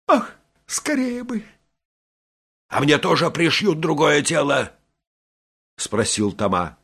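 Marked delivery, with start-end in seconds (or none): 0.1-1.4 squeaky, yearning woman; eager longing. A narrator's reading. Briquet longs for her body. 2.7-4.7 higher, put-on character voice; high, hopeful question; Тома. A narrator's reading. Head of Toma asks anxiously.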